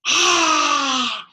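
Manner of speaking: loud, high playful sea monster roar. Acted. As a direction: roar it out for the kids.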